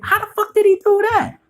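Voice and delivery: high, baffled outcry; stunned audience. Retold or imitated. Moviegoers baffled by the trick, high incredulous question. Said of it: "people was like".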